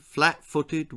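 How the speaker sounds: usual speaking voice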